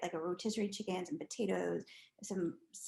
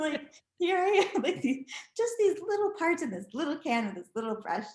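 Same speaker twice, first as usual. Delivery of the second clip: giggling through the words, higher; amused and a bit louder; laughing; 7 semitones higher, 5 dB louder than usual. Real, not acted. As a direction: laugh at your tiny paint job. Laughing at own task.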